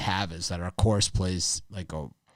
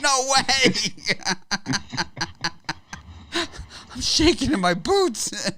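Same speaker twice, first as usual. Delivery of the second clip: high, loud, hammed-up panic with laughter; mock terror; 3 dB louder, slower than usual. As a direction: play a golfer pretending to be terrified of a six iron. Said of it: "It's like".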